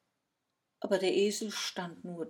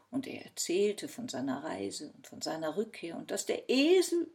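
plain reading voice

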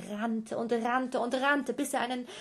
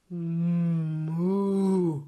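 calf moo; 2 semitones lower, 7 dB louder than usual. Acted. Calf in the sack moos at the giant mother.

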